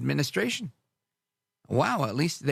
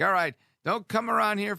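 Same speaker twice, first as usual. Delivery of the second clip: mock threat; 8 semitones higher than usual. Retaliating government warning off intruders, mock threat. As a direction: lightly play at scaring someone off.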